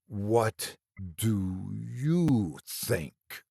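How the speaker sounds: a decayed thing's voice, very slow and soft; dank decayed creature voice. A narrator's reading. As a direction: drag the words out like something rotting. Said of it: "its dank, decayed voice".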